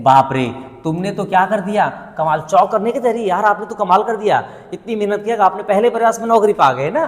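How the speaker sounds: stunned admiration; amazed. Shown as a demonstration. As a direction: gush with surprise at their success. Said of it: "ठीक है".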